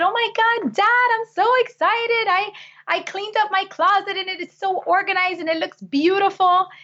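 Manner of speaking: put-on high, gushing little girl voice, bursting with news; excited child. Little girl tells her dad about her closet, high and gushing. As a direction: squeal it out like an eight-year-old showing off her tidy closet.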